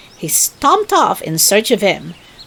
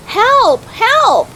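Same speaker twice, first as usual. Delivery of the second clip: high, loud cries for help; crying for help; 5 dB louder than usual. A narrator's reading. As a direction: shout for help, falling in the water. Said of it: "cried Rabbit".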